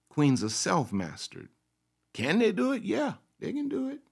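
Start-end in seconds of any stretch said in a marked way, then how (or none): 2.1-4.0 mock high voice. Mock question and answer.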